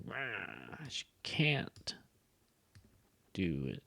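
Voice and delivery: rising stretched CAN'T with a pause; drawn-out can't. Shown as a demonstration. Teacher acting out the pitch drawing on can't. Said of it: "I would draw it like".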